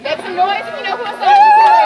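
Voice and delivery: shouted high and loud to the crowd; rally leader shouting to get the crowd to make noise, loud and high; hype shout. Real, not acted. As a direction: whip the crowd up, full voice. Hyping up the crowd.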